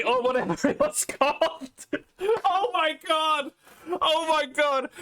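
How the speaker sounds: high-pitched, breaking into laughter; helpless giggles over an absurd memory; real laughing disbelief. Real, not acted. Cracks up remembering absurd show.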